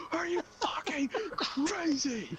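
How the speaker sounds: high, slow, drawn-out furious shriek; mom screaming. Retold or imitated. Mom shrieking at son in the driveway, furious and slow. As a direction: stretch every word into a scream. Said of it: "hearing her voice scream".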